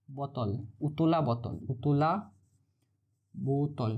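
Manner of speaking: slow, careful উতলা বোতল, syllables forced out; tongue-twisting struggle. Real, not acted. Teacher to students, stumbling over the tongue-twister term. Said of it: "এটা বলতে আমার তো দাঁত ভেঙে যাচ্ছে".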